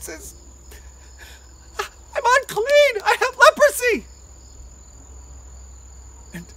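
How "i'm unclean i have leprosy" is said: pleading confession. Acted. Leper confesses to Jesus.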